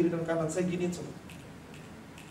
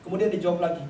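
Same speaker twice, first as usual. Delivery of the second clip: ordinary talk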